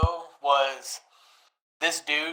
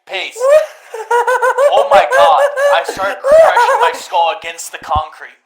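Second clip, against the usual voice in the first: laughing; 8 dB louder than usual. Real, not acted. Laughing while telling the story.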